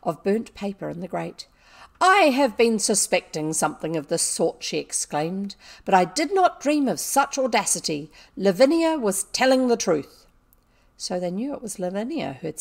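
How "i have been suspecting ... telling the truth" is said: louder, outraged headmistress; angry accusation. A narrator's reading. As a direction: declaim it loudly in indignation. Furious headmistress confronts girls.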